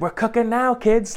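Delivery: gleeful little fiend, hand on the burner; Leprechaun. Retold or imitated. Imitating the leprechaun taunting the kids from the stove, impish. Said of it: "he's like".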